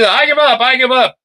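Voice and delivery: panicked henchman. Acted. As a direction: squeal it like a beaten thug giving up. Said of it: "And he".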